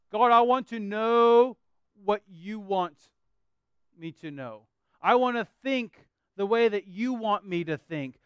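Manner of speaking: slow, earnest pleading to God; slow, earnest prayer voiced from the pulpit; heartfelt prayer. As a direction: pray it out slowly, meaning every word. Prays the closing question aloud.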